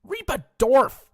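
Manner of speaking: very high, slow, loud whine; whiny voice. Acted. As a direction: wail it like a brat. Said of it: "I'm gonna redo that one".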